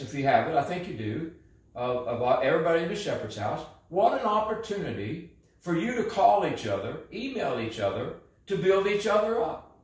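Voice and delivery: ordinary talk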